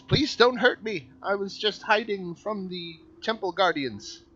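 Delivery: high, loud, pleading cry; frightened plea. Acted. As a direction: beg for your life in a high voice. Frightened NPC begs for life.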